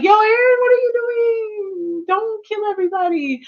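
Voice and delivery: high, mock-pleading call to Eren; a hint of Mikasa calling out to stop him. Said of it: "for her to be like".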